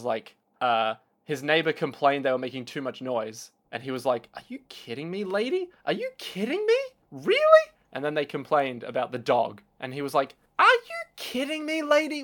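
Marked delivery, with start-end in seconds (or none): 4.3-7.6 mock indignation building into a near squeal; mock outraged rant. Metal singer yelling at neighbor. 10.6-12.2 much higher from the start, held up in disbelief; mock outraged high voice. Metal singer yelling at neighbor.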